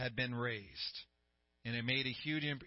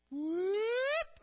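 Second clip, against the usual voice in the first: sharp high squeak of a whoop; 5 dB louder than usual. Acted. Pastor acting the dead man sitting up, high whoop. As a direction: pop it out like a sudden jolt. Sudden sit-up sound effect.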